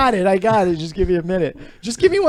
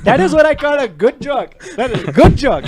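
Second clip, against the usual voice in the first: laughing praise; 6 semitones higher, 8 dB louder than usual. Real, not acted.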